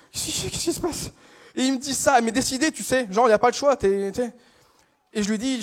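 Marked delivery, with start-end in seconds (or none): 0.1-1.1 hushed, higher-pitched stammer; mock panic. Cashier lost before a baffling order, hushed mock panic. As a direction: stammer softly in helpless bewilderment. Acting out his bewildered panic.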